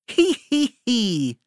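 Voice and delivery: squeaky high mock giggle, starting loud. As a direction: giggle up high like a tickled chicken. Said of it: "The chicken and the pig laugh too".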